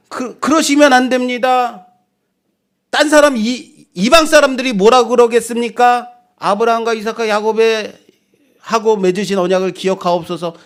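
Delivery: high-pitched re-enacted plea, easing toward the end; startled pleading. Moses protesting to God over Israel, an alarmed plea. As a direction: stammer into an urgent, respectful protest. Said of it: "깜짝 놀라서".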